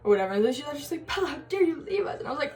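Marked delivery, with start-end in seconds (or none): 1.1-2.2 high, quick scolding imitation; mock indignation. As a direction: play the abandoned friend, outraged in jest. Imitating friend's indignant complaint.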